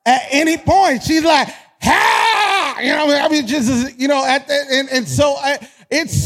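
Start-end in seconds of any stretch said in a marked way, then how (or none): 1.8-2.8 high, drawn-out gleeful shout; mock toddler outburst; excited toddler yell. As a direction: imitate a little kid's party holler. Imitating his excitable daughter.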